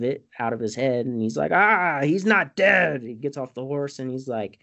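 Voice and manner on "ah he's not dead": slow, high theatrical wail; drawn-out mock-delirious outburst; mock crazed cry. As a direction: cry it out in hammed-up disbelief. Acted crazed cry in retelling.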